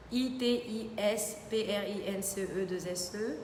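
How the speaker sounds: slow, letter by letter; careful spelling. Real, not acted. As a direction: spell it out slowly for listeners. Spells out her Instagram name. (épellation lente, lettre par lettre)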